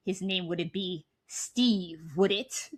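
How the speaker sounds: slow, sly mock-killer taunt; menacing killer voice. Phone killer slyly taunting the girl, slow and sinister. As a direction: savor it like a killer who knows.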